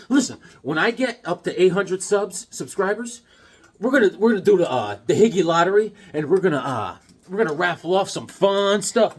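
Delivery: ordinary talk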